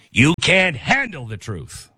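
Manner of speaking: slow, growled movie-villain line; Jack Nicholson impression. Host doing a famous film line to viewers, hammed up. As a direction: channel a courtroom movie colonel, slowly. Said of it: "I'm turning into Jack Nicholson".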